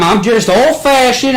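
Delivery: put-on Southern accent, high and loud; old-fashioned said in a loud, high Southern twang; hammed-up Southern insistence; aggressive Southern accent. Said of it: "he got aggressively Southern".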